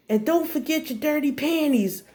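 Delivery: high, carrying mock holler; mom yelling. Mom shouts about laundry while crush is next door, yelling. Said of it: "She literally yelled".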